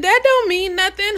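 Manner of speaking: laughing retort. Retold or imitated. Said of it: "And I said".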